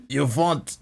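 botched Irish accent sliding into Russian; failed Irish accent. Shown as a demonstration. Podcaster tries an Irish accent on request, comes out Russian. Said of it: "that was a bit Russian".

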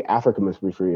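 ordinary delivery

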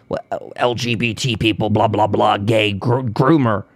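low, dopey mocking voice; internet troll. Mocking someone online ranting about groomers, low imitation. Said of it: "someone on the internet that goes".

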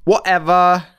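high, loud British brush-off, drawn out; mock British accent. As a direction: dismiss them in hammed-up British. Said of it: "the only word that you do well in a British accent is whatever".

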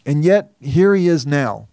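ordinary delivery

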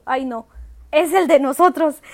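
loud, dismayed cry; loud alarmed realization. Retold or imitated. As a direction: realize it's your own car. Realizing her car is being stripped.

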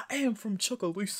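mock the actor's heavy Southern accent, defensive. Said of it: "he put on that heavy accent".

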